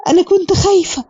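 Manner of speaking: high-pitched, lightly imitated crying voice; mock-tearful, pitched well above normal; retold tearful confession. Crying sister admits fear to her brother, imitated tearfulness. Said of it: "وكيانات بتعيط وبتقول له".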